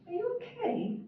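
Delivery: a high, flat-tuned American asking; American accent, high and unmusical; tuneless American accent. A narrator's reading. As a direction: ask it with a plain, tuneless American lilt. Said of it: "she asked in her tuneless American accent".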